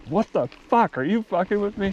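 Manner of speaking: a fed-up man who thinks he's being played; exasperated Billy Bob. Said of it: "he's like".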